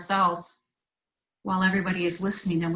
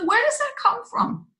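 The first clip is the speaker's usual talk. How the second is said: startled wondering; 13 semitones higher than usual. Retold or imitated. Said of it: "And I was like".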